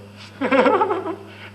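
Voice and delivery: short high-pitched chuckle; a quick high laugh; amused laugh mid-talk. Real, not acted. Speaker laughs.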